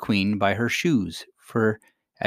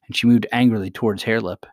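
plain reading voice

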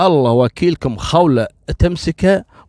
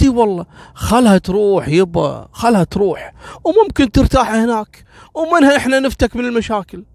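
higher, coaxing and pushy; insistent wife; 6 semitones higher than usual. Acted. Wife Khawla urging her husband to let his mother go, higher and coaxing. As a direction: talk him into it, sweetly pushy.

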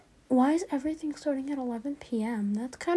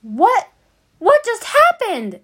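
higher, louder, slow stunned cry; higher alarmed what; shocked; 11 semitones higher, 7 dB louder, slower than usual. Real, not acted. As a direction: react to the blast in disbelief. Shock after explosion.

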